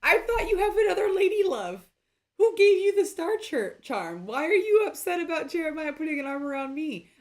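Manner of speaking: high, loud accusing voice; mock jealous girlfriend. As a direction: ham up the jealous lover. Playing jealous confrontation, high voice.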